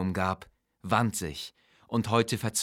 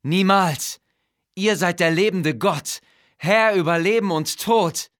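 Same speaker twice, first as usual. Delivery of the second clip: much higher, louder, fawning vizier protesting loyalty; servile vizier; 10 semitones higher, 9 dB louder than usual. Acted. Vizier swears loyalty to the living god, higher and louder. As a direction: heap the praise on like a frightened courtier. Vizier voiced flattering the king.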